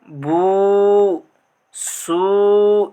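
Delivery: बू and सू with long drawn-out ū; stretched vowels. Shown as a demonstration. Teacher demonstrating long vowels to the class. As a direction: hold each vowel long. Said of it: "इस तरीके से अगर हम खींच करके".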